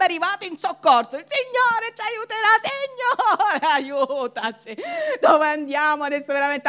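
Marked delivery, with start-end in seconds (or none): 1.2-6.7 high, loud, strained plea; Moses praying in panic. Retold or imitated. Moses begging God with the crowd shouting, high loud plea. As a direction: beg out loud, higher and tense. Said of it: "convincersi e convincerli".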